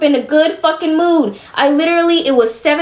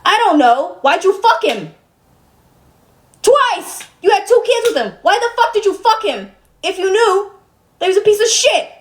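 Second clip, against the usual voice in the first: 1 indignant rant; 7 semitones higher than usual. Real, not acted. Angry rant at mom, pitch up.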